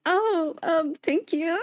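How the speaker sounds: high, awkward polite thank-you. Retold or imitated. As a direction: thank her while unsure it was a compliment.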